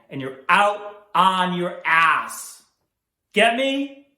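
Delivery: slow, furious bellow; angry shout. Acted. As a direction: roar the threat slowly. Principal threatening a student.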